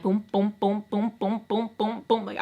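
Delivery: soft boom boom like a pounding heart; heartbeat sounds. Retold or imitated. Nervous outside with Beto behind her, soft heartbeat booms. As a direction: thump out a racing heartbeat. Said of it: "my heart is just going".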